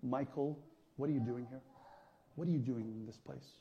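low, quiet, measured call; hushed, low retelling of a divine question; voice of God. Retold or imitated. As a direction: imitate God calling softly and low. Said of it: "like, the voice of God saying to me".

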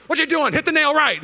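high and loud, a teasing scolding tone; fake-scold the clumsy helper; the scolding he never gave; mock impatient scold. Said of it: "He never was like".

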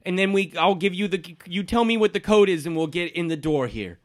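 a hint of a fussy man wanting his briefcase; NPC Derek. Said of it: "he says".

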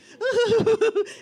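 loud, high re-enacted laughing fit; hysterical laughter. Hostages laughing from gallows humour, hysterically. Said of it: "we start laughing hysterically".

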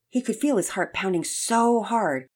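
ordinary delivery

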